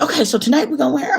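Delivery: high, loud, rapid chatter; excited planning. Retold or imitated. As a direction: imitate a friend gushing about tonight's plans. Birthday friend excitedly planning outfits.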